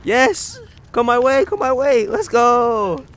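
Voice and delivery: high loud hype; excited cheering. Real, not acted. Cheering on play.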